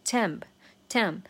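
slow, emphatic "temp"; stressed syllable. Shown as a demonstration. Teacher marking the third beat, stress on "temp". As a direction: isolate "temp" and lean on it. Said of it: "就也是重读".

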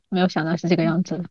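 normal talking voice

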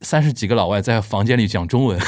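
ordinary delivery

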